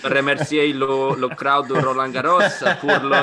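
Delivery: put-on Spanish-accented broken French; lazy Spanish accent. Mocking Rafa's Roland Garros thank-you in a Spanish accent. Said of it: "it's a lazy Spanish accent".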